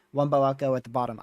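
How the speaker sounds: ordinary delivery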